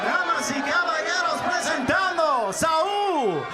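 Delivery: Spanish ring announcer, name stretched long; lucha libre announcer. Acted. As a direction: draw out Álvarez for the crowd.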